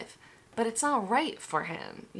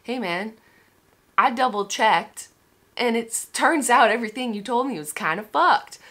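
louder, imitating a confrontation with the seller; hammed-up loud complaint to the shop guy; mock telling-off; 4 semitones higher, 9 dB louder than usual. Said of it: "I wanna tell him".

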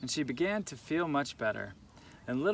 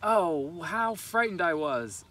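loud, slow frightened outburst; frightened cry; 3 semitones higher, 5 dB louder, slower than usual. A narrator's reading.

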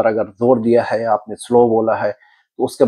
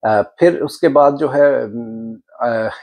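ordinary talk, nothing marked

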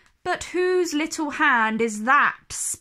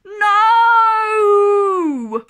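higher, much louder desperate cry; 8 semitones higher, 11 dB louder, much slower than usual. A narrator's reading. Nanny waking as Martha floats away, desperate shout. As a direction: wake up and scream for her. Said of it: "poor Nanny wakes up crying".

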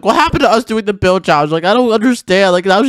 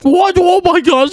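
shocked; 10 semitones higher, slower than usual. Acted. Sees wedding almost finished.

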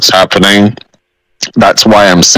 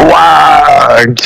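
orc shout; 7 semitones higher than usual. Acted. Orc war cry in game talk.

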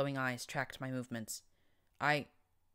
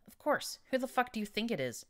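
belligerent, higher-pitched retort; 8 semitones higher than usual. Acted. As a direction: snap back with belligerent attitude. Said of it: "my belligerent tone".

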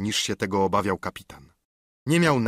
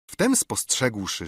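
usual speaking voice

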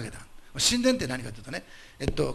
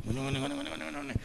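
high, fast squelching mimicry; comic high gooey wriggling sound; squishy wriggle noise; 11 semitones higher, 7 dB quieter, much faster than usual. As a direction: imitate a body squirming back to life. Mimics body squirming back to life.

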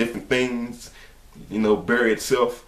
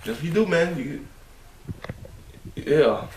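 ordinary talk, nothing marked

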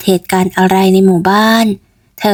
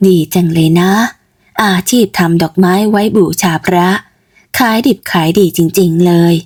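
put-on older mother; 2 semitones lower than usual. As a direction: ask your daughter gently. Mother comments. (แม่ถามลูกสาว เสียงต่ำแบบผู้ใหญ่)

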